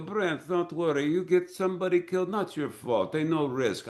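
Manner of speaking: high-pitched mock Russian stuntman; Russian accent. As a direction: play a carefree Russian with broken English. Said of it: "the Russian stunt people are crazy".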